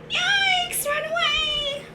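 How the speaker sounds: very high mock panic; panicked squeal. Animals run away from angry bear, panicked squeal. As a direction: squeal it like tiny fleeing animals.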